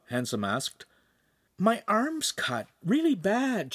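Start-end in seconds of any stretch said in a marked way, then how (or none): 1.6-3.7 put-on high, slow, far-off whine of a hurt girl; injured girl whines to the master; whining. A narrator's reading. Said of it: "she whined".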